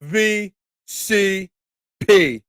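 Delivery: wrestler promo callout, last letter punched; L A Knight style. Retold or imitated. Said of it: "I prefer to go L A Knight style".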